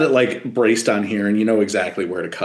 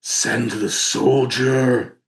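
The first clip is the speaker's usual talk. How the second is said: slow, drawn-out commanding voice in the head; stretched-out, otherworldly captain's order; captain's deep voice; much slower than usual. Acted. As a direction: intone it slowly, like an ominous inner command. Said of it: "a deep voice that you".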